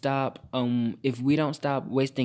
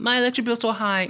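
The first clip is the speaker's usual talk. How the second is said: mock complaint; 9 semitones higher, 3 dB louder than usual. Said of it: "y'all, like, complaining".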